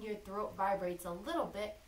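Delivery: normal talking voice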